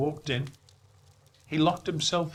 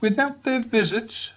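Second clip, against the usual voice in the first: high, louder mournful spirit; Marley's ghost lamenting; 8 semitones higher, 4 dB louder than usual. Acted. Marley's ghost lamenting his chains to Scrooge, high and mournful. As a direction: wail out regret.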